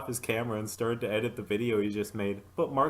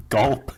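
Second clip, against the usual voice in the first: loud gulp; 3 semitones higher, 10 dB louder than usual. Acted. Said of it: "Mark swallowed hard".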